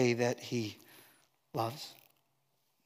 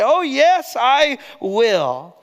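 high, loud burst of assurance, then dropping; acted-out zealous promise; emphatic pledge; 9 semitones higher, 6 dB louder, much slower than usual. Believer answering those who question their faithfulness, emphatic.

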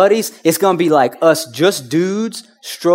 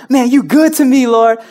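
high, loud joyful praise; praising God loudly in the living room; excited praise; 9 semitones higher, 7 dB louder than usual. Retold or imitated. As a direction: shout thanks upward. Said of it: "just worshiping God".

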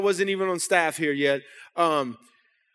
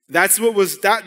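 normal talking voice

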